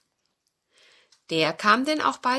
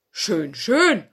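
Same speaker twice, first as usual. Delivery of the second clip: louder, pleased; pleased fox; 2 semitones higher, 9 dB louder than usual. Acted. Fox welcomes the guests, pleased voice. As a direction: purr approval like a fox.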